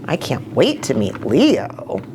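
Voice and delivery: ordinary delivery, nothing marked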